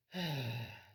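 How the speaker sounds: a loud, low heavy sigh. Shown as a demonstration. Fed up with roommate's dishes, heavy sigh. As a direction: let out a weary heavy sigh. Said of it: "sigh heavily".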